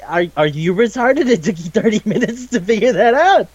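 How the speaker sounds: rising, exasperated disbelief; hammed-up exasperated question; mock incredulity. Acting out disbelief at thirty minutes.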